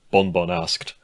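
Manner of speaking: ordinary delivery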